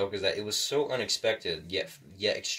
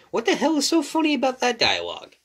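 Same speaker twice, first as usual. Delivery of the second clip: high, loud mock objection; put-on high voice of an objector; voicing a baffled viewer; 9 dB louder than usual. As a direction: squawk the viewer's complaint loudly, far above your usual voice. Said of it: "Now I know what you're thinking".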